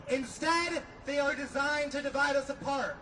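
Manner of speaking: normal talking voice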